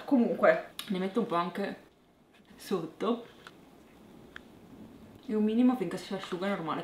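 lips coated with mask; speaking while applying mask; cream mask around mouth. Real, not acted.

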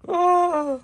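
very high, loud falling scream. Acted. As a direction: yelp as you get thrown. Thrown out the window.

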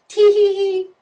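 high, loud mock schoolgirl tee-hee; girly giggle. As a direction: squeal a bratty little giggle. Mimicking the girl's giggle.

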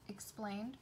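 ordinary delivery, nothing marked

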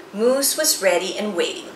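ordinary delivery

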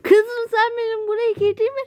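high, startled protest; surprised protest. Real, not acted. Father protesting to his daughter after being found, high and startled. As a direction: caught off guard and objecting.

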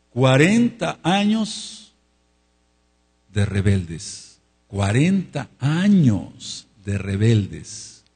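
slow heavy emphasis. Real, not acted. Preacher hammering the point.

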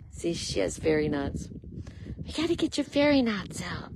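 ordinary talk

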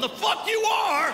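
high, drawn-out defiant shout; outraged refusal. Acted. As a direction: yell it slowly in appalled protest. Acted refusal of the exam.

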